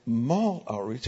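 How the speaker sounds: slow puzzled echo rising at the end; bemused echo of the visitors' request; caught off guard. Retold or imitated. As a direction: repeat it back, baffled. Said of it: "it just caught me off guard".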